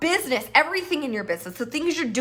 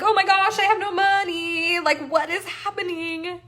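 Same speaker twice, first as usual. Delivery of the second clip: hammed-up broke panic; mock panic; 6 semitones higher than usual. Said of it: "I'd be like".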